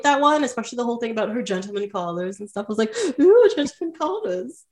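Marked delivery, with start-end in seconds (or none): 3.2-4.6 high, drawn-out ooh, mock thrilled; playfully swooning over romance. Recalling The Glass Menagerie's suitors, mock swooning much higher.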